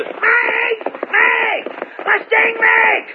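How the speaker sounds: riding in hard, calling out for Mag; yelling. Acted. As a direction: shout her name across the yard from the saddle. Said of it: "he yelled".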